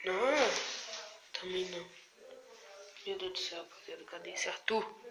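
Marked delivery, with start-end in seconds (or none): 3.1-5.1 whispering. Real, not acted. Scared, searching dark house quietly.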